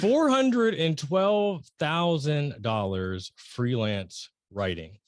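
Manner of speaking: drawn-out, deliberate repeat of the figure; slow for emphasis. Real, not acted. Host repeats her income figure for the audience, very slowly. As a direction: stretch every word of the amount. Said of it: "I'm gonna say it again".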